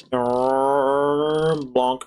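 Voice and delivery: ordinary talk, nothing marked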